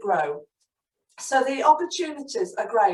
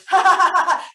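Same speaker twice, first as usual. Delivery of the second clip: higher, louder little giggle; boy's giggle; 8 semitones higher, 6 dB louder than usual. Acted. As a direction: give a boyish giggle. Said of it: "Marvin giggled".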